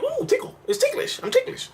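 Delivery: prissy mock squeal of a tickled partner; mock ticklish voice. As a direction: squeal it like a coy lover, hammed up. Mocking a giggly partner in bed.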